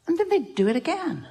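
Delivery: mock exasperation. Teacher retelling a hurt that keeps recurring, mock exasperated. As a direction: play up the repeat in a high voice.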